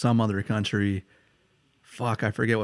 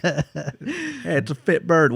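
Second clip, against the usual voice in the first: mock British accent; 6 semitones higher, much slower than usual. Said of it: "using, like, British slang kind of just as a joke".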